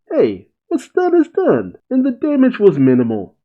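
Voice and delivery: lightly voiced swaggering cat samurai; gruff cat boss. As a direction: bark it out like a brash cat lord. Voicing Nekomamushi's line.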